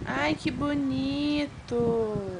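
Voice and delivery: very slow and soft; slow, soft doting praise; tender admiration. Real, not acted. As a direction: coo over it gently. Admiring toddler's nail polish.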